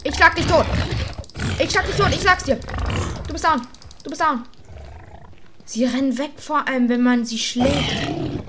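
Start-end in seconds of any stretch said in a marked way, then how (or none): 0.0-4.4 loud, high threats; real gamer battle rage; fierce battle shouting. Real, not acted. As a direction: yell while swinging the sword. Fighting mob, threatening.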